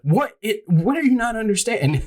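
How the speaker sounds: high-pitched, incredulous outburst; retold exasperated disbelief. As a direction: act out a homeowner at wit's end. Retold exasperated homeowner in quarrel.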